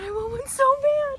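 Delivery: squealing excitedly. Real, not acted. Gushing over cute goats.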